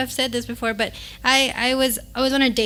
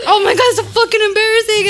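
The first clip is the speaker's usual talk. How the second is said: mortified outburst; 8 semitones higher, 6 dB louder than usual. Retold or imitated. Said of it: "But I was like".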